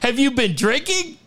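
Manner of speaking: high, mock-scandalized question; incredulous questioning. Retelling employee confronting drunk man.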